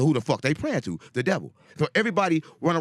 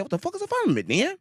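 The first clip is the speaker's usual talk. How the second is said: much higher, incredulous retold question; baffled question; 9 semitones higher than usual. Retelling his own confused reaction, high and baffled. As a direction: squeak it out in disbelief. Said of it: "I said".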